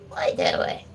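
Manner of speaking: "What you doing?" whispered very low. Real, not acted. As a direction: hush it down low to the dog. Teasing the dog.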